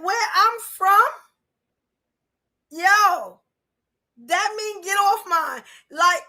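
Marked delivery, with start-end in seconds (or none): none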